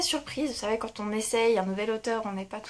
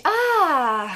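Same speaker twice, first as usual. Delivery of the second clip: sudden delighted recall; 6 semitones higher, 9 dB louder than usual. Real, not acted. Remembers the book she read.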